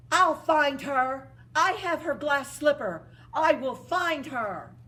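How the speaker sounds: determined vow. Acted. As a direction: swear to find her. Prince vows to find her.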